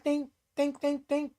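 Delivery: high squeaky "think, think" like a thought chime; imitated chime in the head; high sing-song think. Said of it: "why I was saying think".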